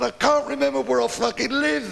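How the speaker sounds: high, tearful punchline wail; crying old man. Retold or imitated. Old man explaining his tears to a stranger, mock sobbing. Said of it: "What are you crying for".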